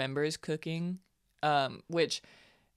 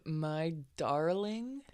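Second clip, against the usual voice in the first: slow, soft and swooning; drawn out like a romance hero; swooning "my darling"; 5 dB quieter, much slower than usual. Retold or imitated. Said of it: "when he said".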